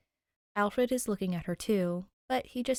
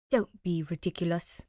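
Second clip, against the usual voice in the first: posh English. A narrator's reading. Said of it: "comes a posh English voice".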